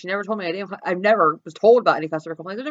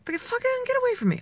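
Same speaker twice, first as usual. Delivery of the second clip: high, mock-exasperated brush-off; annoyed retort; 14 semitones higher, 4 dB quieter than usual. Stranded driver brushing off unwanted advice, high annoyed retort.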